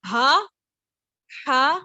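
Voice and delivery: ہا then ہا, each breathed differently; two ha sounds demo. Shown as a demonstration. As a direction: show the two kinds of ہا clearly. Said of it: "clear difference موجود ہے". (دونوں ہا کا فرق واضح کریں)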